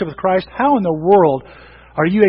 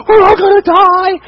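high, loud panicked cry; mock-terror shout; panicked disciples; 11 semitones higher, 8 dB louder than usual. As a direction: wail it like terrified fishermen.

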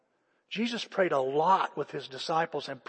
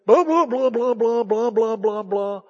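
loud, higher-pitched blah-blah rant; mock complaining; 5 semitones higher, 9 dB louder than usual. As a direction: imitate yourself griping loudly, starting high and easing down.

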